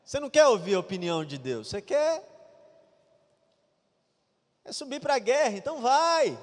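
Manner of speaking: scornful dare, telling him what he wants to hear; sneering sarcasm thrown at the king; mocking. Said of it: "É como se Micaías estivesse assim falando".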